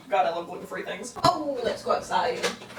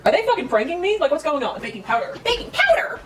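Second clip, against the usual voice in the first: high, loud incredulous rant; exasperated disbelief; 7 semitones higher, 5 dB louder than usual. Real, not acted. Ranting at the recipe in loud disbelief.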